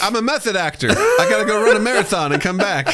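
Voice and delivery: comic high-pitched outburst; mock indignant actor. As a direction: protest high through laughter, as a put-upon actor. Mocking indignant method actor.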